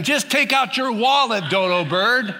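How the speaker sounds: high, laughing scold; teasing Spirit voice. Pretend.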